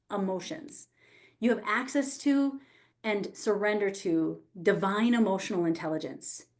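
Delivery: ordinary talk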